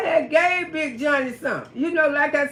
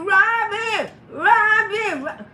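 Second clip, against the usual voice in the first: calling out higher in a panic; hollering for sister; 7 semitones higher, slower than usual. Retold or imitated. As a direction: holler for the sister across the house. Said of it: "He went to hollering for his sister".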